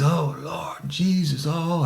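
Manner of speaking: high, drawn-out mock wail; imitated agony. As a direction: mimic a sprayed man calling on Jesus in distress. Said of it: "he's gagging and all that".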